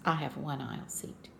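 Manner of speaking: a touch of gruff counter agent. Retold or imitated. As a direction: lightly voice the gruff agent, dropping low. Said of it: "in a rather gruff voice".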